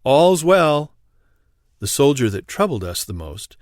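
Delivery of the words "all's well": raised voice, higher and louder; ringing soldier's call; loud sentry cry. A narrator's reading. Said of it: "soldier's loud cry would resound".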